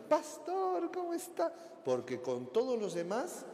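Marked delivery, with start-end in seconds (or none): 0.1-1.5 high, sugary greeting; wife greeting the pastor in a high sweet voice. Retold or imitated. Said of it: "Viene la hermanita".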